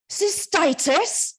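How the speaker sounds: loud, high, drawn-out Cystitis?; barked question. Acted. Mother on the phone barks at her daughter, shocked. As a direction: bark the word in shocked disbelief. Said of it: "Mum barks".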